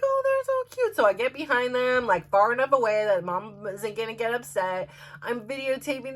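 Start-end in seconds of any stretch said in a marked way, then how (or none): 0.0-1.0 high-pitched gushing over something adorable; cooing delight. Retold or imitated. Woman spotting little ducklings, cooing in delight. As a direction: coo it way up high.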